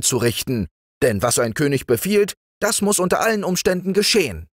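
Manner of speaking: a self-important frog crowned king; pompous frog king. Acted. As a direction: order him about like a little tyrant. Prince commands grandly.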